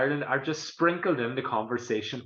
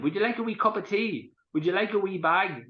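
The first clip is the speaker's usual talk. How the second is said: Donegal phrase demo; 7 semitones higher than usual. Shown as a demonstration. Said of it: "we use it all the time".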